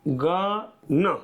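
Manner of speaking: आंगन very slowly, broken as आं, ग, न; syllable by syllable. Shown as a demonstration. Teacher sounding out the word for students, आंगन syllable by syllable. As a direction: draw out each sound of आंगन separately.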